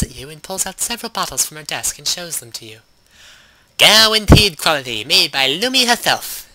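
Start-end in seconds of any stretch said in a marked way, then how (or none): 3.8-6.5 proud. Acted. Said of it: "she says proudly".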